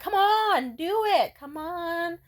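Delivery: high, drawn-out playful whine; cajoling. Retold or imitated. Woman coaxing a reluctant man, playful cajoling. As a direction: wheedle him insistently. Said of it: "And I'd be like".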